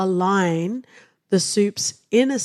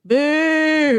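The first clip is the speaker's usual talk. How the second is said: long, higher-pitched jeering boo; playful booing; 7 semitones higher, 3 dB louder than usual. Real, not acted. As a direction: heckle the choice with a drawn-out boo. Jeers at co-host's choice of topic.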